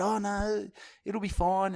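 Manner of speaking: high, soft, breezy mock reassurance; imitating the organiser. Organiser reassuring the comedian, high and breezy. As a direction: brush it off in a high voice. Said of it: "They said".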